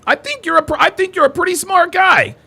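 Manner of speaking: high-pitched mocking imitation; Bean. Host quotes a past flatterer's compliment, mocking. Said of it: "Just like when Bean says".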